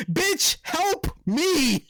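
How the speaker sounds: very high, stretched-out wail for help; hammed-up desperate cry; drawn-out mock plea. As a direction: drag out each word, pitched sky-high. Repeats the plea, exaggerated.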